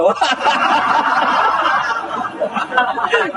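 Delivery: long high-pitched laugh. Real, not acted. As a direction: burst into helpless laughter. Laughs at the story. (tawa panjang bernada tinggi)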